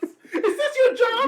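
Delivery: very high, loud, slow laughing question; laughing outburst of disbelief; incredulous laughter. Real, not acted. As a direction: squeal it in disbelief through a laugh. Listener mocks the cop, laughing.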